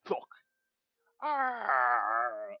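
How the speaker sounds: ordinary delivery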